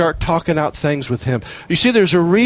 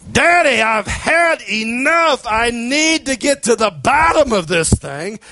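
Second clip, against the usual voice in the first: crying out to God; 6 semitones higher, slower than usual. Acted. Preacher voicing his own desperate prayer, shouting. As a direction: cry out like a child who's had enough.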